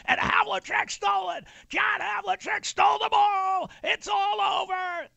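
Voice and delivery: high, shouted gravelly announcer call; grinding stone gravel voice. Retold or imitated. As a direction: scream the steal like a gravel-voiced radio man. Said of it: "with a gravelly voice, Johnny Most".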